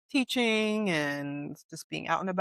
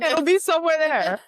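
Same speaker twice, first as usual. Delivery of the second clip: laughing; 12 semitones higher, 8 dB louder than usual. Real, not acted. Joking about subtitle placement.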